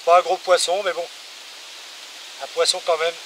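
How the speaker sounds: talking over gusting wind on the mic. Real, not acted. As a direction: speak up outdoors in strong wind. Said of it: "si vous allez m'entendre bien avec le vent".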